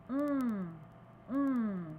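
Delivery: "On" nasal with lips rounded small; teacher demonstrating nasal "On"; nasal vowel. Shown as a demonstration. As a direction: sound "On" like a small O through the nose. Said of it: "avec la bouche comme O".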